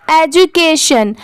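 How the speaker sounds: "Education" slowly, e-ju-kay-shun; slow careful reading. Shown as a demonstration. Teacher introducing the next word, "education" slow and careful. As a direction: draw out each part of "education". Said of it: "ये क्या है".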